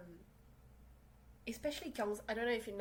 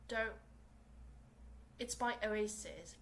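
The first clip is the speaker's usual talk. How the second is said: normal talking voice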